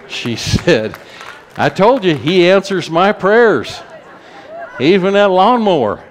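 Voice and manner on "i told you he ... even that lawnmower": raised, louder proclaiming of the answered prayer; excited church-lady boast, high and loud; triumphant testimony. Retold or imitated. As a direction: crow it like a testimony, voice lifted high.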